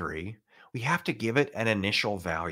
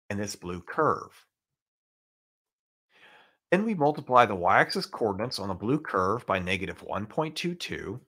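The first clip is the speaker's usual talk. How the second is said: ordinary delivery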